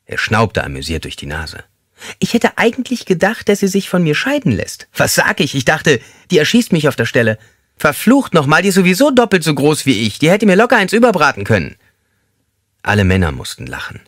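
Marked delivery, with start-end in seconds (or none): 2.2-11.8 high, thin, squeaky man's voice; squeaky-voiced inmate joking with the group. A narrator's reading. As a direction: pipe it up high like a small squeaky man. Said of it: "mit seiner piepsigen Stimme".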